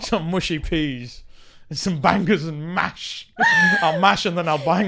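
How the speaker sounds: slow, drawn-out mock British; British accent. Shown as a demonstration. Host making British food innuendo, British accent. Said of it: "a little bit of a British accent".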